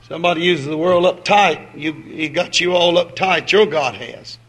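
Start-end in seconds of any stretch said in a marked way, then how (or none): none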